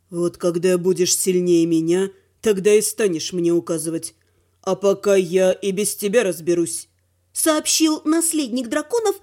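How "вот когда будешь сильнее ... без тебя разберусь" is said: low male voice, dismissive; cold, low-pitched authority; arrogant dragon heir. A narrator's reading. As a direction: put the younger one down in a lowered voice. Narrator voices Velor's put-down.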